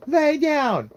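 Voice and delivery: put-on very high, loud kid shriek; bossy screaming toddler. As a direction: shriek orders like a tantruming child. Toddler screams orders back.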